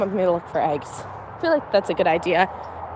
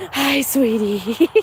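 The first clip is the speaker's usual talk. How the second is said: cooing greeting; much slower than usual. Real, not acted. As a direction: melt over the animal. Cooing to an animal.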